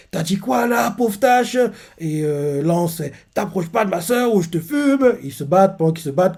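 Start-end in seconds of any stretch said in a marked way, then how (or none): 0.1-1.6 high, sneering challenge; picking a fight. Retold or imitated. Dot squaring up to Lance, high sneering challenge. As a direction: taunt him, spoiling for a fight. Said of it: "Et Dot fait".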